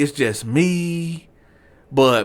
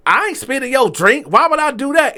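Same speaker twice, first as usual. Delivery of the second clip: elevated, indignant protest; 10 semitones higher than usual. Retold or imitated. Waiter denying spitting in a customer's drink, elevated indignant voice. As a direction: voice jumps high in imitated outrage. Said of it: "he said it loud ... voice got elevated".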